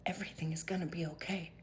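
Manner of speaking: soft whisper of comfort; gentle whispered reassurance. Real, not acted. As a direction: whisper it like a secret promise. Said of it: "I'm gonna now".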